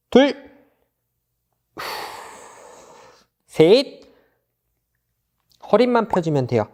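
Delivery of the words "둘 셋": barking gym coach. Acted. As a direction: call each count like a gym coach. Coach counting held stretch. (헬스 코치처럼 크게 구령)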